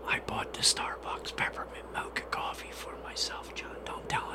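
secret whisper, breathy and hushed. Real, not acted. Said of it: "Don't tell anybody".

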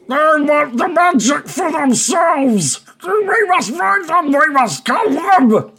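high, slow goblin war cry; goblin boss shouting. Acted. Goblin king rallies his goblins from the throne, shouting. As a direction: proclaim it in a high-pitched creature shout. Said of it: "he shouts out in Goblin".

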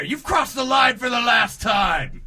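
angry boss yelling. Acted. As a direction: bellow at your staff like a furious boss. Boss calls lawyers in.

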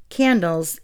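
ordinary talk, nothing marked

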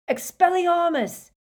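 high, loud disarming cry; yelled spell. Acted. Harry disarming Stan, loud yell. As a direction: shout it straight at Stan. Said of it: "Harry yelled".

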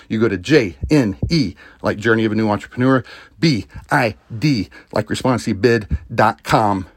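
loud, upbeat ad read. Acted. Host reading a sponsor commercial to listeners, loud and upbeat. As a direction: pump up the sponsor spot.

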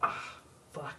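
breathy under-the-breath swear, annoyed at not knowing; frustrated hushed curse. Real, not acted. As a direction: mutter it to yourself, caught out. Guessing the movie, annoyed.

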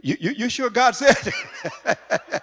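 high, loud, drawn-out doubtful question; hammed-up disbelief, stretched out high and loud; incredulous questioning. People doubting Moses about what God said, incredulous.

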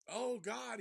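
sudden high, loud gasp of horror; freaked out. Retold or imitated. Skeeter opening the coffin, a high loud horrified cry. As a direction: blurt it out in sheer panic. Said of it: "he freaks the fuck out".

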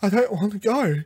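high, weepy regeneration line; teary Doctor pretending to cry; mock teary Doctor. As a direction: ham up the sad goodbye. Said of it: "have your big teary every time you regenerate".

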